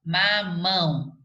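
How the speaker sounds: "mamão" slowly split, MÃO leaned on; stress on last syllable. Shown as a demonstration. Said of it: "a sílaba tônica é a última sílaba".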